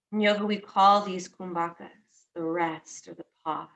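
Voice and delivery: guided meditation. Acted. Teacher guiding listeners into noticing their breath, calm guided meditation. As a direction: ease each phrase out and let it settle.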